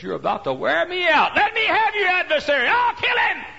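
high, very loud exasperated yell; furious shout. Acted. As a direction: explode in defeat. Judge gives up in fury.

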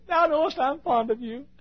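hammed-up Peter hedging his love; mock Peter. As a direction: squeak out the evasive answer. Peter answering Jesus.

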